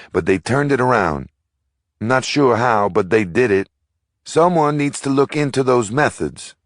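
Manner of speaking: a local woman urging neighbors not to lose hope; a young woman at the meeting. A narrator's reading.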